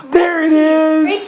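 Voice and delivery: excited. Real, not acted. Parent praising toddler finding tummy.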